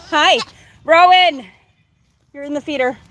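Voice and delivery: ordinary talk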